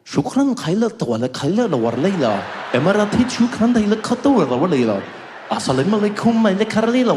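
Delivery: gibberish mock Arabic, sing-song and lilting; mock Arabic safety video. Comic mocks an airline safety video, in mock Arabic gibberish. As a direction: parody an Emirates safety video in fake Arabic. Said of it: "the video comes on".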